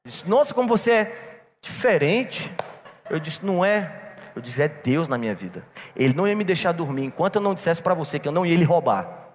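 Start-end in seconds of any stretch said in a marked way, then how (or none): none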